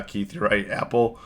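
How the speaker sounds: usual speaking voice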